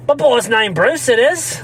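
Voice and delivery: hammed-up, drawn-out Texas accent; badly faked Texas accent. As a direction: do a dad's Texas accent, not very well. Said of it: "do the accent better".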